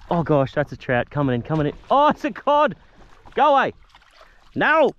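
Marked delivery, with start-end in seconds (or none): 1.9-4.9 high, loud alarmed cry; excited shriek. Real, not acted. Angler yelling at a cod chasing his trout, high and loud. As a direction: shoo the cod off in a panic.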